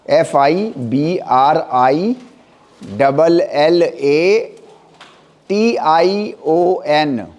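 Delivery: very slow letter-by-letter spelling; drawn-out letters with small gaps between. Shown as a demonstration. Teacher spells a term for students.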